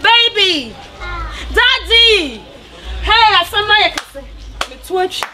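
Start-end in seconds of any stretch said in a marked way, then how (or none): 0.0-4.2 yelling her complaint at him; shouting. Acted. Shouting at Daddy in argument.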